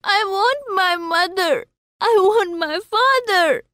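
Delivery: put-on high, wailing little-boy voice; crying child. A narrator's reading. Lost child cries to kind man, high acted wail. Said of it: "The child wept and only cried".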